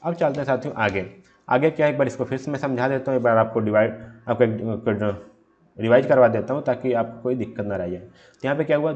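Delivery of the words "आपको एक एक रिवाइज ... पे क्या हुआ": ordinary delivery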